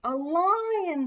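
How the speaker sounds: high, loud amazement; impressed exclamation. A narrator's reading. The whole class hears of a lion, impressed cry. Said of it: "The whole class is impressed".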